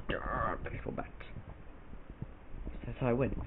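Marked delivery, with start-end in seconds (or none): 0.1-1.1 slow, secret whisper of a weapon name. Retold or imitated. Gamer whispering his favourite Fallout weapon, slowly. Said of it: "I just go".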